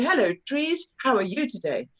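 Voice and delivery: high, sing-song greeting to the trees; playful dad talking to nature. Retold or imitated. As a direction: chirp hello to the trees like a cheerful dad. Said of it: "And then he'd go".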